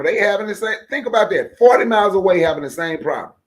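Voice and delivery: hammering the point home, getting fired up; heated rant. Real, not acted.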